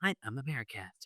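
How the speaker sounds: a soft, lightly put-on American accent. Shown as a demonstration. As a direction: murmur it in a mock American accent. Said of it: "This is my A I voice from America".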